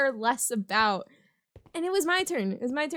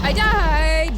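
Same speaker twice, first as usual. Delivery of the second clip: loud, higher happy yell; shouted; 4 semitones higher, 8 dB louder than usual. Real, not acted. Reacting to dying in the game, loud yell. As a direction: cheer your own death in the game.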